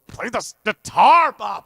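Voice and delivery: high, pushy fan voice shouting for the guitar; mock demanding fan. Viewer nagging the streamer to play, mock demand. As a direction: play the bossy fan who wants a song now.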